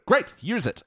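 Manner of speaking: high-pitched, punchy comeback; acted eager retort. Tutor answers a pupil not in the mood, acted eager retort. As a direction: pounce on it, pitched right up.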